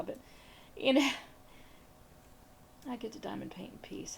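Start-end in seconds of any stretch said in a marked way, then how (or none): 2.9-4.2 unvoiced gleeful whisper. Real, not acted. Wife gloating softly over a peaceful evening alone, whispered. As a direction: hush it like a happy secret. Relishing time alone while husband away.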